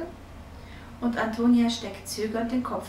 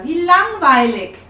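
loud, high, drawn-out moan of boredom; bored complaint; 7 semitones higher, 12 dB louder, slower than usual.

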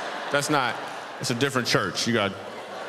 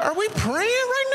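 high startled question. Retold or imitated. To the friend praying unasked, high slow disbelief. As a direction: voice jumps way up, slow and incredulous. Startled reaction to sudden prayer.

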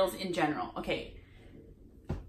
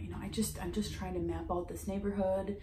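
near-whisper, much softer pleading; quiet voice; 7 dB quieter than usual. Retold or imitated. Saleswoman softly explaining she is passionate about books, hushed. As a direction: hush it right down, almost whispering. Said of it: "her voice got quiet".

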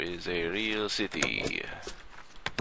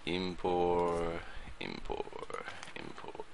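fading repetition; 8 dB quieter, slower than usual. Acted. Inspector mumbling the city name, fading. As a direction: let "impor" trail off to nothing.

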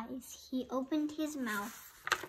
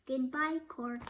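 ordinary delivery, nothing marked

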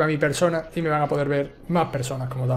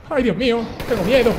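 higher, louder frightened cry; real fear; 7 semitones higher, 4 dB louder than usual. Real, not acted. Streamer frightened while creeping through the game, loud scared cry. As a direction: yelp it like you're truly scared. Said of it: "Tengo más miedo que un perro chico".

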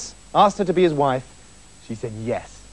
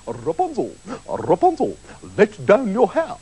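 theatrical prince's call, rising at the end. A narrator's reading. Prince calls up to Rapunzel's window, theatrical rising call. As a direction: call up grandly, rolling into the name. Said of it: "when the prince came and called".

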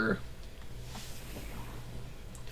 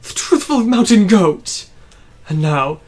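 grandiose villain voice; 9 dB louder than usual. Acted. Voicing villain boss speech.

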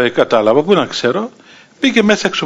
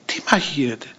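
hushed question; 2 semitones lower, 8 dB quieter, faster than usual. Retold or imitated. Bystander asking about the rumoured fight, hushed. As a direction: ask it under your breath.

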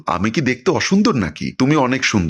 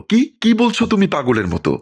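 higher, astonished disbelief; surprised; 8 semitones higher than usual. A narrator's reading. Husband shocked at his wife's words, surprised and higher. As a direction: blurt it out in shock, pitched up. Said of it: "অবাক হয়ে বলল".

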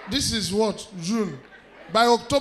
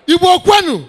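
loud high rallying call; rally cry shout; 6 semitones higher, 11 dB louder than usual. Acted. Comedian calling out to Igbo in crowd, shouting. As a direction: call the crowd like a chant leader.

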